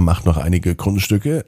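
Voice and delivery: plain reading voice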